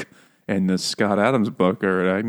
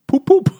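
very high, louder "Poop, poop" with a laugh; engine honk imitation; 7 dB louder than usual. Imitating the car engine for friends, high laughing honk. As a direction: honk like a tinny old engine. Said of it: "that's the sound of the engine".

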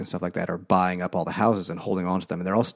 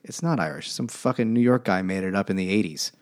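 ordinary talk

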